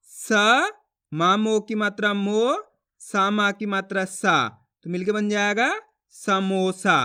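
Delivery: slow stretched सा and rising मो, then blended samosa; drawn-out reading demonstration for learners; letter-by-letter sounding out. Shown as a demonstration. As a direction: model each syllable separately, pausing, then join the word. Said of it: "किस तरीके से एक ही अक्षर का उच्चारण किया जाता".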